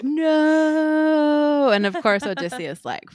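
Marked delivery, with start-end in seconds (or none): 0.0-1.7 mock cry. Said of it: "And it's like".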